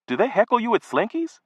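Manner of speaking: high, startled question; shocked. Acted. Boy asking his mother about hecklers, high and shocked. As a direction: sound caught off guard by the news. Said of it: "Shocked, I said".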